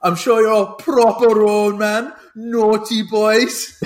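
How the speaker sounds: high, slow put-on posh line; imitating Charlie Hunnam. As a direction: drawl it like the film. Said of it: "And he goes".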